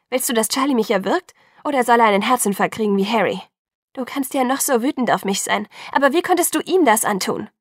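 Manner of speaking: loud, rising angry accusation; hurt and furious, voice climbing; angry outburst. A narrator's reading. Bella confronts Jacob angrily.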